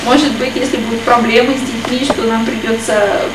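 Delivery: usual speaking voice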